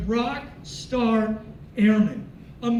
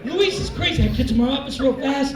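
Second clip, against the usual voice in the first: normal talking voice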